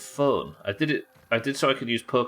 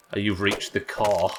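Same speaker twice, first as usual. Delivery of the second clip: ordinary delivery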